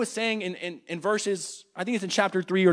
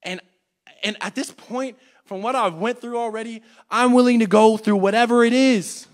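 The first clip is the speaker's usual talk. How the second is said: ordinary talk, nothing marked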